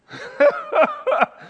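high, loud laugh; short burst of high laughter. Real, not acted. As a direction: laugh out at your own joke. Laughs at his own mockery.

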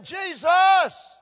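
high, loud shout of Jesus. Acted. Preacher shouting the name to the congregation, loud. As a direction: cry his name out with full force. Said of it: "the end result of saying".

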